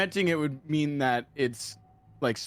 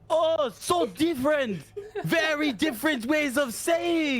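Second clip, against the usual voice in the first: mock French sarcasm; 8 semitones higher than usual. Mocking the friend who claims pronunciations differ, sarcastic French accent. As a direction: draw it out in scornful French caricature.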